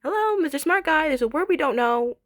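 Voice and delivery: high, sing-out sassy call to a know-it-all; mock summons. Dima calling on the smart guy, mock demanding. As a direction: imitate an impatient call for help. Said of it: "that's li-, basically her saying".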